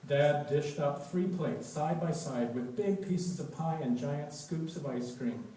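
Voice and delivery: plain reading voice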